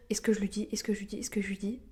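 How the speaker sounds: anxious repeated whisper. Retold or imitated. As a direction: whisper it over and over, unsure. Retold anxious inner question.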